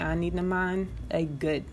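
ordinary talk, nothing marked

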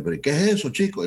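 incredulous Cuban boss, pitched well up; mock amazement in a Cuban voice; Cuban accent. The manager seeing his logo on the poster, Cuban accent. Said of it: "con el acento cubano".

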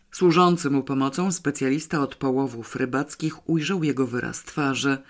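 plain reading voice

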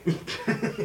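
short breathy chuckle; quick laugh at the story; real laugh. Real, not acted.

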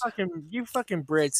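usual speaking voice

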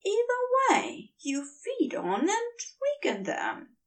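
high, earnest angel voice; Aziraphale's voice. A narrator's reading. The angel tells the demon he is different, high and earnest. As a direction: lift the voice high and keep it gentle.

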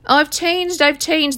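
sarcastic imitation of a manipulator; mocking whine. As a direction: mimic an insincere ex swearing they've changed. Mocking the returning narcissist.

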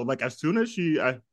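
normal talking voice

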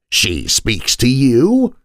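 slow, drawn-out surprised question. A narrator's reading. The old maid learns the girl speaks to the ghost, surprised. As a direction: let the surprise stretch each word. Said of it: "Bertha seemed surprised at this".

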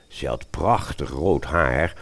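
ordinary talk, nothing marked

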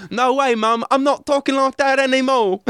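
high, mock teen refusing mum; hammed-up British teen voice. Teen defying mum, in a high mock British voice. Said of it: "how do people decide, like".

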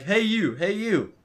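calling out. Retold or imitated. Stranger calling out to them.

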